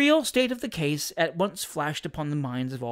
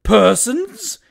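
loud, high indignant echo; outraged exclamation; 8 semitones higher, 11 dB louder than usual. A narrator's reading. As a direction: throw the word back in outrage. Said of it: "ejaculated Missus Taunton".

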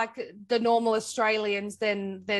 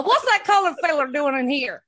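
loud, higher mimicked farmer; copied old farmer; 6 semitones higher, 9 dB louder than usual. Co-host copying the farmer's follow-up, loud mock farmer voice. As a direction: parrot the old farmer loudly, pitched up. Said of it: "if I can try and copy you".

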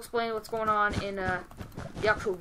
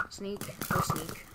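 whisper; 3 semitones lower, 5 dB quieter, much slower than usual. Pretend. Sneaking up on enemy team.

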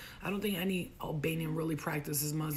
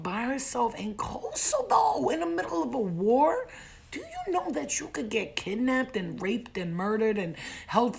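exasperated scolding; 3 semitones higher than usual. Acted. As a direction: scold in disbelief, building as it goes. Soldier scolds her, acted.

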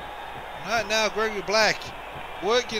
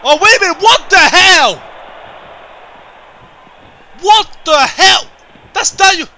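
much higher, much louder startled yelling; high-pitched stunned outcry; shocked shout; 9 semitones higher, 12 dB louder than usual. Real, not acted. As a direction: yell in disbelief at the interruption. Sudden run-in during match.

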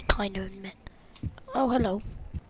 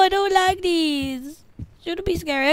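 tearful; 5 semitones higher, slower than usual. Real, not acted. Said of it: "I'm nearly crying".

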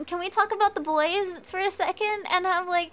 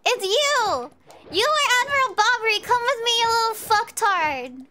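loud, high shout of accusation; mock-outraged accusation; 6 semitones higher, 6 dB louder than usual. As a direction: call him out at full voice, playfully furious. Accusing Bobbery aloud in game.

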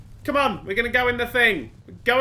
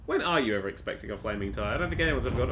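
ordinary talk, nothing marked

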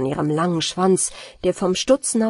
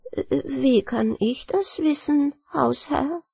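higher, slow, feigned innocence; innocent voice; 5 semitones higher, slower than usual. Acted. Rat feigns innocence to the master, higher and slow. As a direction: play the wide-eyed innocent, pitched up. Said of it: "fragte die Ratte mit unschuldiger Stimme".

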